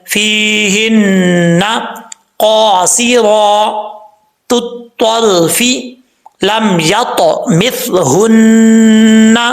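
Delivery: each word recited slowly and carefully; slow practice reading. Shown as a demonstration. Tajweed teacher reads the verse slowly for practice. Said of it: "practice के तौर पे पढ़ते हैं".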